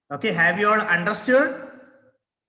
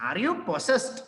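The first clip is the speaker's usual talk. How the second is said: mock accusing question; 6 semitones higher than usual. Shown as a demonstration. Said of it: "you can ask".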